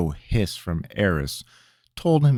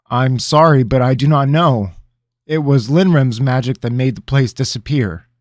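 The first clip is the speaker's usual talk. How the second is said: high, loud anxious apology; a lightly voiced frightened young man; nervous pleading; 7 semitones higher, 9 dB louder than usual. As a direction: plead for belief. Rabineau pleading.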